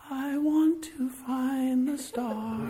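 put-on high, slow little girl's voice; a small child announcing her wish. A preacher voicing a little girl, high and slow.

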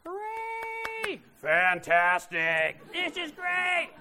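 crowd cheering. Retold or imitated. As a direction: cheer like a whole auditorium. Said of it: "recorded his own voice over a hundred times".

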